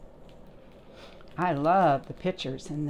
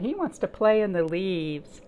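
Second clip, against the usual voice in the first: ordinary delivery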